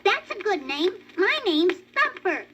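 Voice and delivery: high little rabbit asking; baby rabbit voice. A narrator's reading. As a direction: voice a small eager bunny. Thumper introduces himself.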